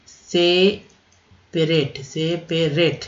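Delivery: Separate said very slowly, se-pe-ret; very slow drawn word. Shown as a demonstration. Teacher spells out separate for the class. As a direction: linger on each part of separate. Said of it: "रेट है ये".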